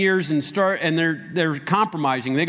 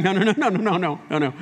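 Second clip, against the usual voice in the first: quick string of chuckled noes; imitated laughing denial; faster than usual. [name] correcting a passerby, imitating laughter through denials. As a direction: act out laughing too much to explain. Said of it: "And she's laughing".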